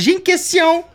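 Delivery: high, bright call of a keen listener; shouted out with a jump up in pitch; eager call-out. Shown as a demonstration. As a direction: pop up with the question like a kid in class. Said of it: "Tu vas être capable de dire".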